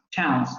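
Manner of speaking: chance said with a flat open a, like chanss; other accents' pronunciation. Shown as a demonstration. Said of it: "En otras variantes del inglés sería chance".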